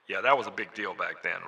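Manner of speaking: normal talking voice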